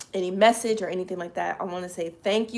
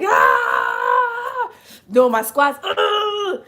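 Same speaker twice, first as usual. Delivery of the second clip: retold workout scream; 6 semitones higher, 7 dB louder, much slower than usual. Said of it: "If y'all gotta scream".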